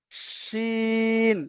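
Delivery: শিন with a thick শ and held vowel; heavy শ, stretch শি; long shin. Shown as a demonstration. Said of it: "শিনের উচ্চারণটা আসবে". (মোটা শ দিয়ে টেনে শিন)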